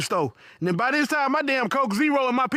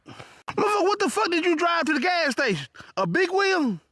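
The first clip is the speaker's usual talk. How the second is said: higher, incredulous rant; mock outrage; 6 semitones higher than usual. Real, not acted. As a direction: jab at him in disbelief. Ranting at the customer.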